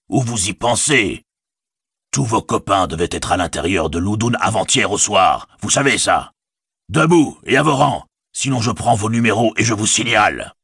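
voix d'orque qui monte au cri; menace, puis crie l'ordre; orque menaçant. Acted. L'orque menace les déserteurs. (orque qui menace les hobbits)

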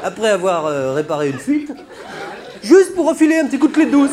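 loud comic rant climbing to a high yell; indignant, pitch soaring at the end; mock exasperation. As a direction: build to a shrieked punchline. Mock outrage, absurd plumber example.

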